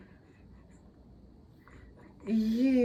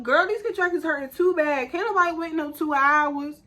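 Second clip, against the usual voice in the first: high, raised plea to the nurses; exasperated plea; 9 semitones higher than usual. Retold or imitated. Laboring mom pleading high with the nurse for medicine. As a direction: whine it up high, fed up with pain.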